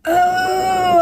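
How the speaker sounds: high crying shout of pain; retold scream. Recalling the tube going back in, a pained high cry. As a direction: cry out as if it really hurts. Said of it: "I mean, I'm screaming".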